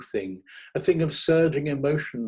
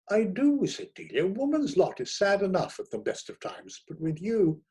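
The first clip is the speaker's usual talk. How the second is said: high woman's voice, firm; Delia, decided; 7 semitones higher than usual. A narrator's reading. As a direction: dismiss him, voice raised high. Delia breaks off with him.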